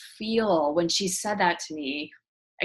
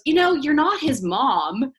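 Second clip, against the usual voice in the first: slow, higher, louder imitation of a sassy son; mock sarcastic; 7 semitones higher, 7 dB louder, slower than usual. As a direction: drag it out like a kid setting mom straight. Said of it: "he's kind of my sarcastic little guy".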